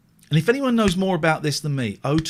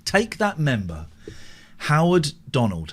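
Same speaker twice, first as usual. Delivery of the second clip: ordinary talk, nothing marked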